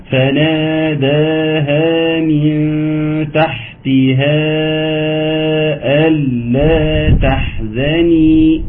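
slow drawn-out tajweed chant; Quran recitation. Shown as a demonstration. Teacher reciting Sura Maryam to students, slow chanted recitation. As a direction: recite slowly, stretching the vowels. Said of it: "عاوز أقف على ألا".

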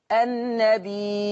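النبي with a long held ī closed by a glottal stop; slow, sustained النبي ending on a catch in the throat; recited with hamza. Shown as a demonstration. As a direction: chant النبي, stretching the final ī into a hamza. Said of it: "تقرأ".

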